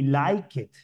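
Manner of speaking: "like it" with -ed as its own syllable; mispronounced -ed. Shown as a demonstration. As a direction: add a wrong "it" beat after "like". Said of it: "nunca se va a decir".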